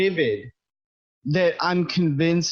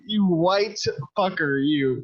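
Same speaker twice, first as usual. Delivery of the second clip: ordinary talk, nothing marked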